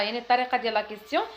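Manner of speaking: ordinary talk, nothing marked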